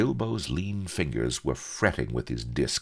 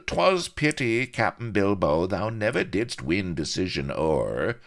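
mocking pirate rival; 6 semitones higher, 3 dB louder than usual. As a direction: jeer at him in a lofty pirate voice. Avery mocking Bilbo, pirate voice.

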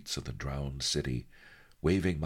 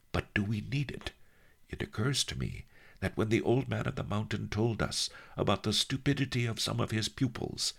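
higher-pitched voice of a scheming sage; Master Lee, musing; 6 semitones higher than usual. Acted. Master reasons out the labyrinth to his helper, musing. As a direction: think aloud in a high old master's voice.

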